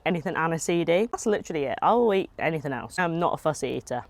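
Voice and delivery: normal talking voice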